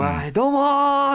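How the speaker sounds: high, bright stage hello; cheery MC greeting. Retold or imitated. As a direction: greet the crowd like an eager MC.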